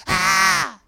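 high, loud mock scream; little-girl scream. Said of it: "screaming like a little girl".

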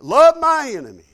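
loud, high, incredulous echo; indignant disbelief. Retold or imitated. Young believer balks at what he just read, loud indignant disbelief. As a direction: throw the command back, offended. His indignant reply to the verse.

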